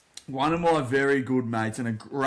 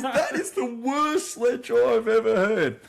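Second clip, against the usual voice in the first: high, slow, laughing mock contempt; laughing scorn; 10 semitones higher, 4 dB louder, slower than usual. Teammate mocking the sledger, laughing and high. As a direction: sneer through laughter, pitched up. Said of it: "He goes".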